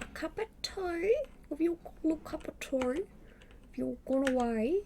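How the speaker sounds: high, coaxing voice offering tea to the audience; mock sweet hostess.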